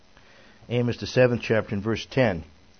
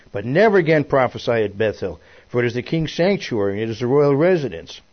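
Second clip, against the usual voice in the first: loud, measured proclamation of prophecy; emphatic scripture reading; 6 dB louder than usual. A narrator's reading. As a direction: read the verse out with force. Reads priest's command from scripture.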